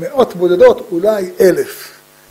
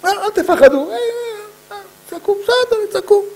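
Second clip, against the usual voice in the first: high-pitched, waving off the fuss; squeaky-high mock unconcern; dismissive reassurance; 13 semitones higher than usual. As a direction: brush off their shouting in a raised pitch. Waves away the opponents' shouting.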